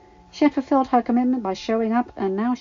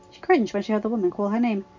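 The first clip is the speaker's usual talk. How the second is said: plain reading voice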